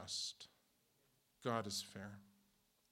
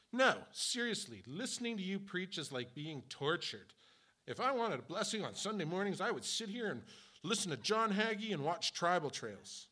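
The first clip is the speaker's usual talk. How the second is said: mock critic; 7 semitones higher, 4 dB louder than usual. Critic's insult acted out.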